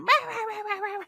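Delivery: high nonsense imitation of a rant; mock nagging. Colleague having a go over birthday wishes, mock ranting. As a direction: yap mockingly in a high voice. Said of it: "This one's having a go at me".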